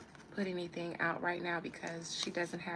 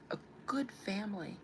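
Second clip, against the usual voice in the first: ordinary talk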